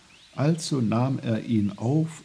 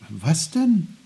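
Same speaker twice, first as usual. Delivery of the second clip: raised, louder surprised question; 6 semitones higher, 5 dB louder than usual. A narrator's reading. Boy questioning his little old uncle, surprised. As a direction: ask it taken aback. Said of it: "fragte Peter überrascht".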